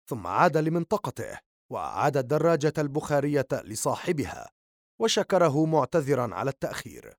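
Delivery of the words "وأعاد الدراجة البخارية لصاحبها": ordinary delivery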